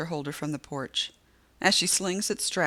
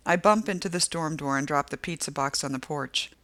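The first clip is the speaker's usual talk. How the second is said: ordinary delivery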